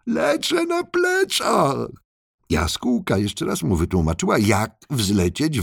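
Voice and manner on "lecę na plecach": very high, drawn-out call. Acted. Turtle flying on its back, calling out high and slow. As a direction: call slowly in a high, stretched voice. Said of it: "wołał".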